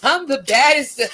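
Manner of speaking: high, loud, slow mocking wail; mock whiny creator. Mocking a creator who got insulted, high and slow. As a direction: drag out the shocked diva whine. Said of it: "You like".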